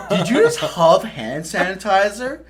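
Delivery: loud, high disbelief; incredulous sergeant. Retold or imitated. First sergeant confronting the recruit, loud incredulous question. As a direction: demand it like an outraged sergeant.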